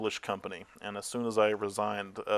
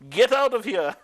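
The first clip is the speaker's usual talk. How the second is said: loud, high imitation of an angry boss; angry sales boss; 13 semitones higher, 7 dB louder than usual. Sales guy throws trainee off the floor, angry mock shout. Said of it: "he throws me off the floor".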